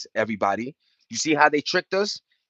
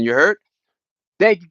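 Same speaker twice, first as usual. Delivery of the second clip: ordinary talk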